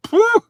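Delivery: loud, high-pitched "phew"; high whooped phew. Real, not acted. Driver reacting to two dollars for fifteen miles, high whooped phew.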